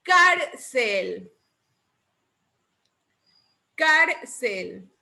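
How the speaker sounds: Cárcel with a punched CÁR; Cárcel in two careful syllables, first emphasized; stress on Cárcel's first syllable. Shown as a demonstration. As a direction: hit CÁR, let cel drop in Cárcel.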